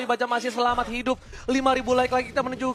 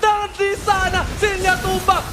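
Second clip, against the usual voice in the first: excited shout; 5 semitones higher than usual. Real, not acted. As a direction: shout it out as the fight swings. Caster reacts to team fight.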